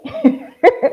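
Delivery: high amused laugh. Real, not acted. Woman amused by her own polite brush-off, high laugh. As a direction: let out a high, short laugh.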